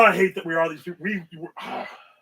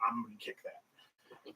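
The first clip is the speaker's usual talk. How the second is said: much lower, much softer and slow, savoring it; slow scheming murmur; 10 semitones lower, 22 dB quieter, much slower than usual. Retold or imitated. Spotting tape on the gym floor, slow low murmur. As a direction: drag the words out like a gleeful secret. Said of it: "You're like".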